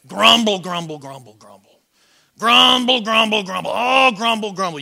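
hammed-up grumbling, trailing away and surging back; mock morning grouch; grumpy grumbling. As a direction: grouse your way out of bed.

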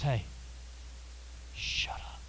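soft, tender whisper; gentle whisper. Retold or imitated. Jesus gently hushing the stormy sea in a whisper. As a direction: hush it gently like soothing a child. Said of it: "his sweet, gentle, loving way".

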